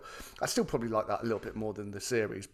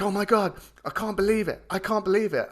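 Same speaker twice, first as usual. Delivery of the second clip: imitating a dad thrilled beyond belief; higher, gushing retold amazement; excited disbelief; 8 semitones higher, 4 dB louder than usual. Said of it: "like, with excitement".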